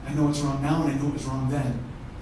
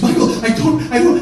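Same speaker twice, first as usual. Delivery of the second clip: high, louder, stammered protest; panicky wife; 6 semitones higher, 5 dB louder than usual. Retold or imitated. Wife balking at the count of three, high and panicky. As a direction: imitate her balking in fright.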